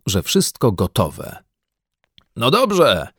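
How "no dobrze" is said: higher, called-out agreement; raised-pitch shout of assent; shouted back. A narrator's reading. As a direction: call back, ready to go. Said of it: "odkrzyknął pan Brown".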